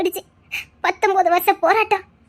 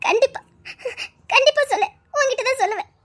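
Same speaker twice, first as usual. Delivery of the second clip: tearful voice catching on the words; crying; 5 semitones higher, 3 dB quieter than usual. Acted. Moved to tears by the young man's promise, crying. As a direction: speak through tears, moved by kindness. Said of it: "அழாதக்கா".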